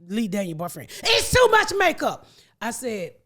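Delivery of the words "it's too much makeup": high, loud outcry of objection; imitated complaint. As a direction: mimic someone objecting, pitched way up. Voices boyfriend objecting to makeup.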